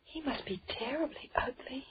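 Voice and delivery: whispered aside, unvoiced. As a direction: hush it so the host can't hear. Whispering about the strange host.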